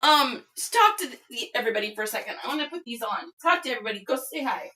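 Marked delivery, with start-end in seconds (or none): none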